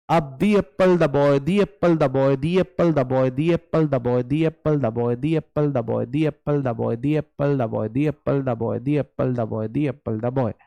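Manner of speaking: "दी एप्पल द बॉय" repeated with dee, then da; drill the and apple. Shown as a demonstration. Teacher drilling the class on "the apple, the boy", over and over. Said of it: "ग्यारह बार मैंने इसको pronounce किया".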